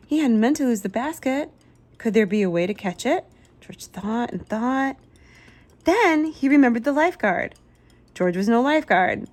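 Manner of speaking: ordinary delivery, nothing marked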